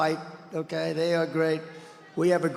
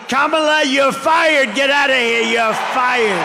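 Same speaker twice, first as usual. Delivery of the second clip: loud emphatic rally shout; 6 semitones higher, 7 dB louder than usual. Real, not acted. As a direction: bellow it at the crowd. Rally chant firing Harris.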